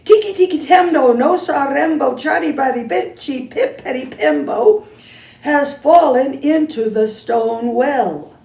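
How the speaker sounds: put-on boy shouting the news; shouting urgently. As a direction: hurry the old man with a shout. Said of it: "shouted Chang".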